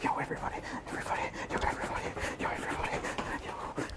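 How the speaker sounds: hushed, breathless whisper to the camera. Acted. Streamer whispering to viewers outside a house, hushed whisper. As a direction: whisper it like a secret you can't contain.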